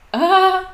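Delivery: high, loud startled ah; startled yell. Real, not acted. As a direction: yelp as if suddenly hit. Sudden in-game fright. (luide schrikkreet)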